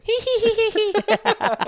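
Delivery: very high, squealed hee-hee giggle; high hee-hee laugh. Shown as a demonstration. Host redoing his laugh for a friend who asked, high hee-hee. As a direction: repeat a squeaky mouth-call laugh on request. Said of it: "Can you do that laugh one more time".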